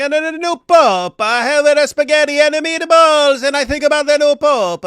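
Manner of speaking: loud, high, hammed-up Italian accent; mock Italian accent. Host demonstrating how Cameron should read the pope's homily, mock Italian accent. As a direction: belt out a cartoonish Italian accent. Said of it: "in an Italian accent".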